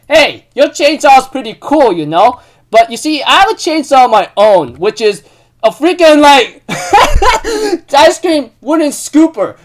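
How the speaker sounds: put-on high, loud, cocky boy voice breaking into a laugh; high mock boast with a laugh; Yukihiro's voice. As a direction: play the cheeky chef showing off his silly tool. Voicing Yukihiro in anime recap.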